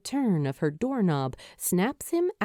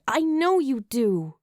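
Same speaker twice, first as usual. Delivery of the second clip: weary, sighing words; tired sigh; 5 semitones higher than usual. A narrator's reading. Julie answers Luke, sighing and tired. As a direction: let it out on a tired breath. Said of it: "she sighs tiredly".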